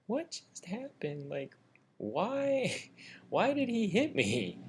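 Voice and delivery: high, drawn-out, whiny bewilderment; mock bewildered whine. Punched man on the ground asking why, high dazed whine. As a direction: play up a slow, high-pitched dazed protest.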